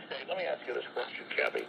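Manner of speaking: ordinary talk, nothing marked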